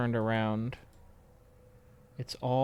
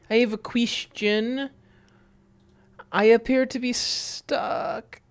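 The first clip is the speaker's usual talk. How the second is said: high, stagey puzzled heroine; mock high character voice; 12 semitones higher, 6 dB louder, much slower than usual. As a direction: play the stuck detective, higher.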